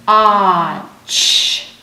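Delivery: "Ah, ch" drawn out loud as the letter name; saying Och. Shown as a demonstration. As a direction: sound out "Ah, ch" loudly. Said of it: "say the name".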